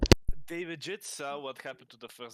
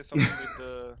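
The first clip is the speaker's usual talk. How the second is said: dopey cartoon bellow; 13 dB louder than usual. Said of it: "Are you pretending to be the big guy?".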